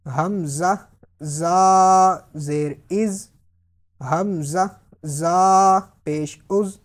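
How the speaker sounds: long held "زا", buzzing hard z in "از"; slow spelling drill, whistle the z of "از" firmly; drawn-out "زا", sharp sibilant ending; whistling z. Shown as a demonstration. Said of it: "سیٹی کی آواز نکالنی ہے".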